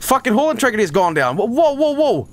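high-pitched cries of alarm. Real, not acted. Gamer sees hull integrity has gone down, alarmed. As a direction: call out in rising alarm.

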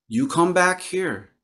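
lightly voiced grandmother calling her back; Grandmother Moon voice. Grandmother Moon instructs the girl.

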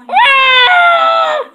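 very loud, very high put-on wail; hammed-up bawling scream; crying wail. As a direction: burst into a tantrum cry. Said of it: "I start crying".

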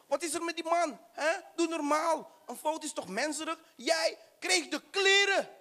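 high, huffy ranting voice; indignant ranting. Acted. Friend ranting at clerk. (hoog en verontwaardigd tegen de vrouw uitvallen)

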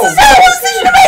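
fast, loud, high panic; panicked shout. Real, not acted. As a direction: blurt it out in a frenzy. Panic over whom to shoot.

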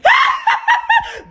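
high shrieking laugh. Real, not acted. Laughing at a Valentine's date, shrieking cackle.

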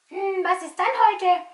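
curious puppet voice; puzzled puppet. Pretend. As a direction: puppet wondering aloud.

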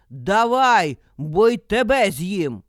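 loud wolf threat; shouting. A narrator's reading. Wolf threatens to eat the old man, shouting. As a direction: shout it as a menacing demand. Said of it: "і кричить".

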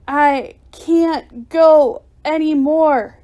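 loud, high, slowly strained wail; exhausted cry. A narrator's reading. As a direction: strain each word out as strength fails. Exhausted flyer losing her power.

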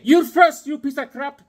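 high, loud jokey jab; mock insult. Buddy returns the joke insult, high and playful. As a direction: fire it back as a friendly insult.

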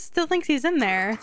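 ordinary talk

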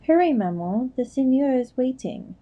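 high, lightly voiced children calling out eagerly; young kids urging their mother to hurry; children crying out. A narrator's reading. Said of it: "a chorus of childish voices cried".

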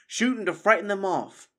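high, loud instruction; crying out an order. A narrator's reading. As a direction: call it out to the archers. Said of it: "the abbot cried".

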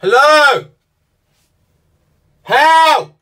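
very loud, high, drawn-out yells; shouting for help. Real, not acted. Trapped in a lift calling rescuers, shouting. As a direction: yell to be heard through the walls.

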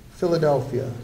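usual speaking voice

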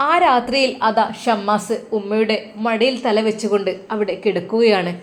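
ordinary delivery

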